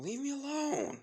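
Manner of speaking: loud, high-pitched yell at an attacker; desperate yell. Acted. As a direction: cry out to fend off an attacker. Acted victim yells at attacker.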